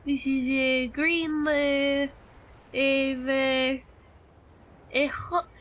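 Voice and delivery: hammed-up, mock French accent; horrible French accent. Streamer playing up a horrible accent redeem for chat, hammed-up French accent. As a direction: put on a deliberately bad French accent. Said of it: "It wouldn't be French".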